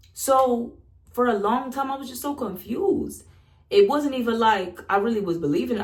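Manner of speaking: ordinary talk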